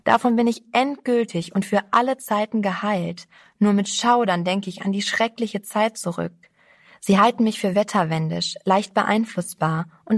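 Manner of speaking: usual speaking voice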